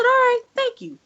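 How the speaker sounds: overly nice thank-you, covering annoyance; fake sweet thanks. To the FedEx agent, a sugary falsely polite thanks.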